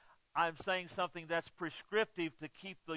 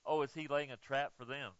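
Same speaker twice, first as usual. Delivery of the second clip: ordinary talk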